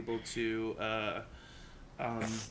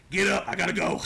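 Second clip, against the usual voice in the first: a country tiger waking his friend with a laugh; thick country accent; 6 semitones higher, 9 dB louder, much faster than usual. Acted. Tiger nudging a fallen friend awake, thick country accent. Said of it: "in a thick country accent".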